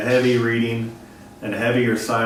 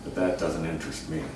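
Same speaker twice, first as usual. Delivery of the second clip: a much lower, quieter voice, bored and dismissive; bored brush-off; 9 semitones lower, 10 dB quieter than usual. Retold or imitated. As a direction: dismiss her like she barely exists. Uninterested crush voiced.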